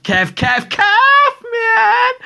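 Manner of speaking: fired-up shout; excited shout. Real, not acted. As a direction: yell it out like a fan. Excited hype about African football.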